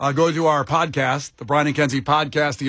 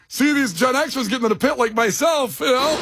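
higher, laughing retold line; retold reply; 9 semitones higher than usual. Host retelling his answer to Megan, higher and amused. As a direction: tell it with a grin. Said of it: "I go".

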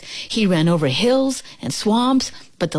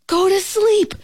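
high, startled exclamation; shout; 14 semitones higher than usual. A narrator's reading. Toad hears the last item on his list, shouting. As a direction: shout it in sudden excitement, voice way up. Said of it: "shouted Toad".

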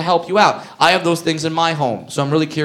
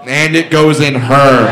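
louder, slow, punch the word goes; punchline comeback; 3 semitones lower, 5 dB louder, slower than usual. Retold or imitated. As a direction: hit it like a winning comeback.

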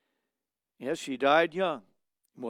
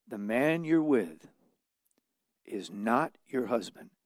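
slow solemn; 4 semitones lower, slower than usual. Retold or imitated. Delivering God's message slowly.

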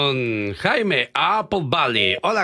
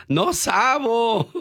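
mock kid; 9 semitones higher, much slower than usual. Mocking a kid's broken Spanish.

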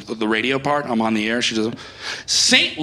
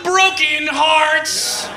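higher, slow sung-out tag; mock jingle; 10 semitones higher, 4 dB louder, slower than usual. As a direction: sell the sponsor then sigh.

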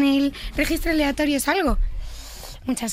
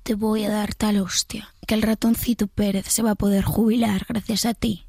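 whispered imitation of the threat; 2 semitones lower than usual. Shown as a demonstration. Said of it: "Ahora la susurrada".

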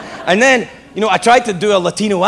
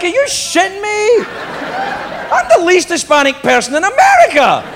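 incredulous protest; 12 semitones higher than usual. Retold or imitated. Comic protesting to Rick about his casting, high incredulous protest. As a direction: squeal it in mock outrage.

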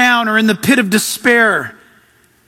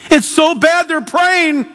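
high, slow mock horror; drawn-out, high-pitched dismay, imitating a scandalised onlooker; hammed-up shocked concern; mock alarm; 6 semitones higher, slower than usual. Mocking alarmed religious people.

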